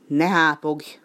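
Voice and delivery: annoyed penguin growling at the noisy duck; growled at the duck. Acted. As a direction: snap at the quacking duck. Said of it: "mordult a kacsára".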